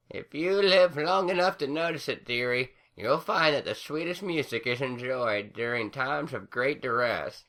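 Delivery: put-on high old crone voice, sage; high old granny musing; wise old granny. A narrator's reading. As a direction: share old wisdom gently. Granny Smith figure voice.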